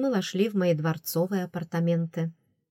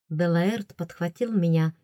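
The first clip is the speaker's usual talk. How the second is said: ordinary delivery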